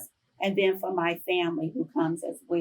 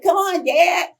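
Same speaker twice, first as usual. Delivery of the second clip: excited welcome; 9 semitones higher, 5 dB louder than usual. Retold or imitated. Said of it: "I'm like".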